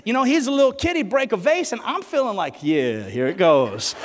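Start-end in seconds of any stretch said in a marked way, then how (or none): 2.6-3.9 low, drawn-out relish; knowing anticipation. Acted. Kid watching his brother about to get punished, knowing anticipation. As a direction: savour it slowly, pitched right down.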